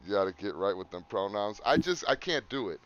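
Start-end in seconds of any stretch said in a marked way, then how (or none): none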